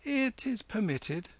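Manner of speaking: high-pitched, regal consent; very lofty. A narrator's reading. Kim granting the hillman leave to serve, very lofty. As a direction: grant it with great condescension. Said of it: "said Kim more loftily than ever".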